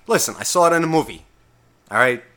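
ordinary talk

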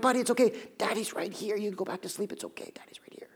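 soft voice fading into a bare whisper; dad's bedside murmur dropping to a whisper; soothing whisper. Acted. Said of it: "And I, and I just whisper".

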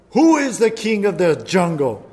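lion booming at the zebra; lion's demand. A narrator's reading. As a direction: puff up like a proud lion.

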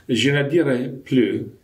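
put-on slow, poorly accented French; terrible French accent. Quoting Monsignor Lefebvre in French, with a terrible accent. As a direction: attempt French in a clumsy foreign accent. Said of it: "excuse my terrible accent".

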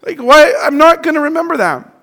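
high, loud incredulous who cares; exasperated. Retold or imitated. Inner voice reacting to a friend's mustard reminder, exasperated. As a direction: scoff at the pointless reminder.

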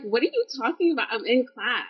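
high-pitched, incredulous comeback; replaying bewilderment at a wild claim; retold baffled protest. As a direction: protest in disbelief, pitch jumping up. Retold protest at being reported missing.

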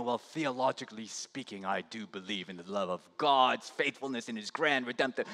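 mock dry academic tone, soft and quick; a dull, detached lecture voice; stoic dry theologian. Preacher mocks a dry scholar's take on Paul. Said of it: "he's not stoically writing these things".

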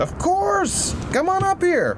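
very high, bright eager call; excited. Acted. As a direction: wave him up with glee. Dad eager invitation.

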